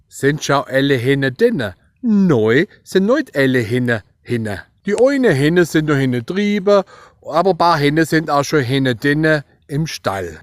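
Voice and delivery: broad Swabian dialect sentence; Swabian accent. Shown as a demonstration. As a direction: rattle it off in thick Swabian. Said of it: "einen netten Satz für euch kreiert". (breiter schwäbischer Dialekt)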